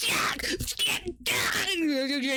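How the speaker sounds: high, giddy repeated yeah; lightly voiced manic cultist; gleeful yeah. Cultist stabbing himself, lightly voiced giddy yeahs. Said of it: "Wilkir Bitterroot's like".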